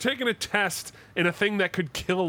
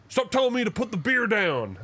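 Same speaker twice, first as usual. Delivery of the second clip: a lighthearted instructor quote, pitched higher; joking retort; 8 semitones higher than usual. Retold or imitated. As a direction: toss off the line as a playful gag. Jokes back as the instructor.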